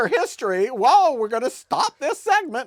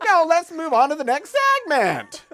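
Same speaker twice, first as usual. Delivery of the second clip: mocking imitation; 7 semitones higher, 3 dB louder than usual. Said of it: "you're like".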